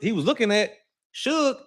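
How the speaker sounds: ordinary talk, nothing marked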